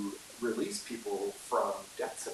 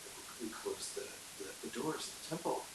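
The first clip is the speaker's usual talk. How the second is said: hushed, amazed whisper; awed whisper; 3 semitones higher, 10 dB quieter than usual. Retold or imitated. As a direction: whisper it like momentous gossip. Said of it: "historians always mark it down like".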